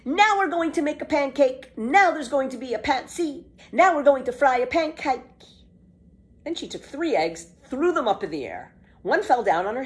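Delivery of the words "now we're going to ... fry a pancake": loud, high excited chant; excited cry. Acted. Pippi announces pancake-making in the kitchen, loud and gleeful. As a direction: shout it out with glee. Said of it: "Pippi cried".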